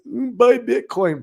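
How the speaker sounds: lightly mock the paid advice; mock pundit. Mocking a pundit who charges people, lightly voiced. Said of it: "Who pays that guy?".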